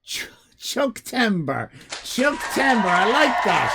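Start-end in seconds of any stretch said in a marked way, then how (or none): none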